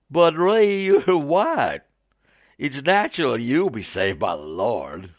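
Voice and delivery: starts high and loud, settling into a Southern drawl; mock Southern American; Southern American accent. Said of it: "my poor attempt at a Southern American accent".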